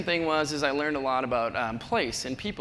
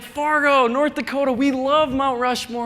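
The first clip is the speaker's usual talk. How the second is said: higher, louder, slow mock tourist; gushing tourist; 9 semitones higher, 6 dB louder, slower than usual. A tourist mixes up the Dakotas, higher louder mock voice. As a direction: ham up the confused fan, slow. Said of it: "And they're like".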